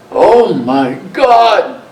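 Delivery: retold scream. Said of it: "And screamed".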